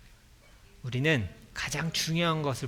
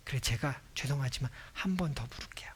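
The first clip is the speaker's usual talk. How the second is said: whispered prayer; 8 dB quieter than usual. Retold or imitated. Humble repeated prayer.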